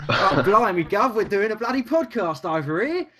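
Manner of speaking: high, hammed-up Cockney British accent; mock British accent. Joking about doing a podcast, mock British Cockney accent. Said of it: "It's got all British".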